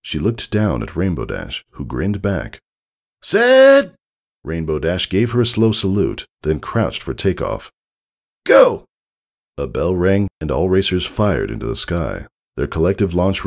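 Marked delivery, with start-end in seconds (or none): 3.2-3.9 high, loud starter's call. Acted. Starter calls the race. 8.4-8.8 high, loud starter's call. Acted. Starter calls the race.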